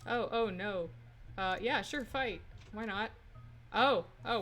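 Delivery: usual speaking voice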